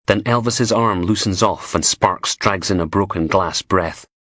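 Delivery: plain reading voice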